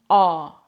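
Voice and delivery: long open ah with no r sound; drawn-out ah, the r dropped; British silent R. Shown as a demonstration. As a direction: say the letter R as ah, British style. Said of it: "They don't pronounce it like R".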